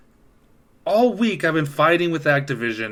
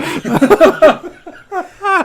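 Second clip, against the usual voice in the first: cracking up loudly; loud high laugh; 9 dB louder than usual. Real, not acted. Laughing at own robot bit.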